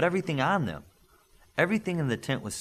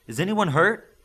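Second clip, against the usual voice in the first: raised high call to everyone; 7 semitones higher, 6 dB louder than usual. Acted. Father calls out to the stuck people, asking loudly. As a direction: ask the whole tent, loud.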